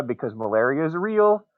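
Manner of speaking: ordinary talk, nothing marked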